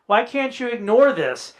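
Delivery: ordinary talk, nothing marked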